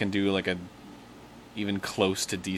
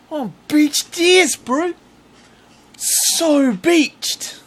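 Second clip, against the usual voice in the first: loud, high-pitched Kiwi accent; hammed-up New Zealand bro, high and loud; 11 dB louder, slower than usual. Shown as a demonstration. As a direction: cry out in a put-on Kiwi voice. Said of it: "I'm doing a New Zealand accent".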